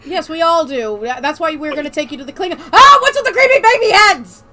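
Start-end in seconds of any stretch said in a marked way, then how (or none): 2.7-4.4 shocked. Acted. First take, shocked.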